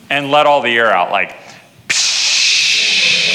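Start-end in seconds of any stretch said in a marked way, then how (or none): 1.9-3.3 deflating hiss. Retold or imitated. Air let out of life.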